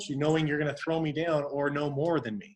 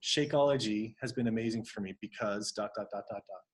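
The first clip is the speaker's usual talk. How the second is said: lower, softer, understated pitch; calm, collected; 4 semitones lower, 4 dB quieter than usual. Shown as a demonstration. Coach showing how to share a shake testimonial, calmly. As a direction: ease down and let it trail off softly. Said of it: "you just calmly, collectively say".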